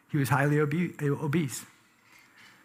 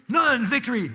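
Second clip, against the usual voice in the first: None, victory, higher and louder like a triumph; a playful victory shout; mock victory cry; 8 semitones higher, 6 dB louder than usual.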